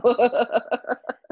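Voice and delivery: real laugh. Real, not acted. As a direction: laugh along lightly. Interviewer laughs after "I know.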